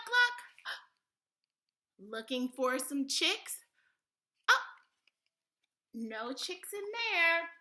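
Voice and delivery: lively picture-book voice with big pitch swings; sing-song storytime delivery, full of exaggerated discovery; animated children's story reading. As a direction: read to a toddler with playful animal noises.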